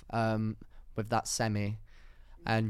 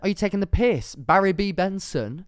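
higher, louder incredulous outburst; mock-outraged rant; 7 semitones higher, 6 dB louder than usual. Co-host rants about a bee romance, loud mock outrage. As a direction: protest loudly, drawing out the name.

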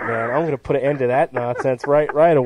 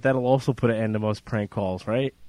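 normal talking voice

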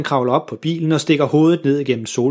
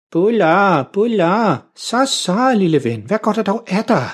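lightly raised, cooing voice to the dog; fussy neighbour lady; 6 semitones higher than usual. A narrator's reading. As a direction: coo at the little poodle. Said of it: "siger fru Jørgensen". (fru Jørgensen kurrer mildt til sin hund)

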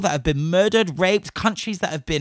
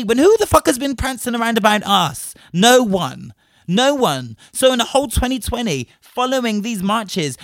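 loud, higher, indignant outburst; real anger; 5 semitones higher, 5 dB louder than usual. Real, not acted. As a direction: demand an answer, fired up. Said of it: "Because I am angry".

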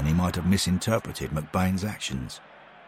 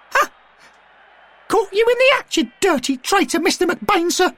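put-on very high, loud boyish crow; young Derek, triumphant; 6 dB louder than usual. A narrator's reading. Derek gloats over McBain in the mud, very high and loud. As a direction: crow over the caught traitor.

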